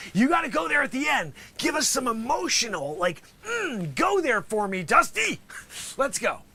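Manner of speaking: ordinary talk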